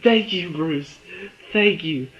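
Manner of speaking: mildly hammed-up relief and gratitude; emphatic thanks. Acts out own grateful reaction.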